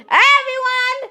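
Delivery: loud, high greeting call. Pretend. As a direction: hail the whole crowd. Child repeats the call.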